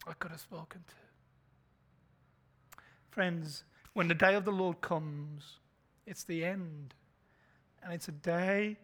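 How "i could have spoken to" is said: slow, whispered regret; fading whisper. Pretend. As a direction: let it die to a whisper.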